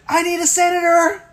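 high, loud, hammed-up plea; mock cry for help. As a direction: wail it like a helpless child calling out. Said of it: "the same way anybody would say".

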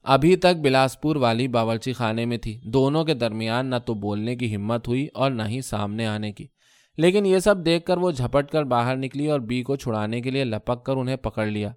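usual speaking voice

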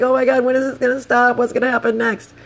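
high, worked-up disbelief; retold alarm. Rider mid screaming match, retold high-pitched alarm. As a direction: re-enact a high-pitched what-now moment. Acted panic mid-fight.

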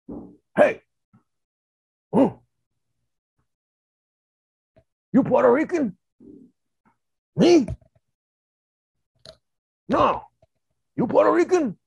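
high, loud, dim-witted primitive voice; clueless caveman exchanges, grunts between; ape man with some language. Retold or imitated. Two ape men asking about being Puerto Rican, high primitive voices. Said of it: "a different version of an ape man".

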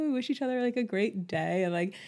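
normal talking voice

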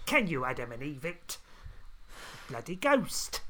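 loud Cockney geezer, rhyming slang; Cockney accent. Shown as a demonstration. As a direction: lay the Cockney on thick and loud. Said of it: "Does it sound like you doing a Cockney accent?".

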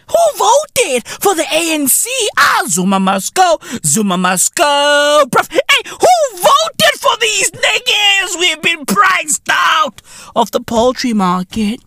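high-pitched mock outrage; freaking out. Comedian imitates voters priced out of eggs, freaking out. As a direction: send up panicked voters, voice climbing high.

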